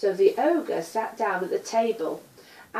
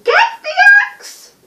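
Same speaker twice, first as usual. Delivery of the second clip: loud, high, slow retold yell; shouting; 16 dB louder, slower than usual. Teller re-shouting Jack's call to his mother, loud and high. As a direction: repeat his shout for the children. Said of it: "he's shouting".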